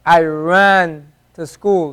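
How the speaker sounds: "I ran to school" slowly, with an English r in "ran"; the wrong way, English r in "ran"; slow demo of the English r. Shown as a demonstration.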